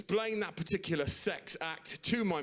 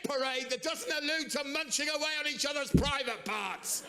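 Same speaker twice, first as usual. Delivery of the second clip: out loud outburst; 13 semitones higher, 10 dB louder than usual. Retold or imitated. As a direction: retell it as a strained public rant. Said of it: "Out loud in a shop".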